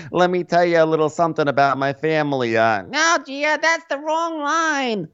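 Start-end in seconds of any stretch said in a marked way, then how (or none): 2.8-5.1 very high, slow correction; mock director. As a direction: stop her way up high, drawn out.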